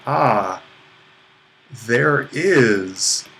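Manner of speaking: emphatic "there is" with stress on "there". Shown as a demonstration. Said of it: "And this is saying".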